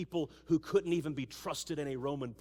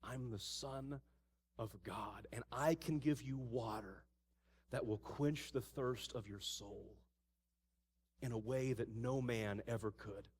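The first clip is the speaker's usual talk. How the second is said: hushed, soft and slow; quietly revealing himself as the Messiah; tender Jesus voice; 6 semitones lower, 10 dB quieter than usual. Acted. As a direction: speak gently, as if offering a gift. Jesus speaks to the woman.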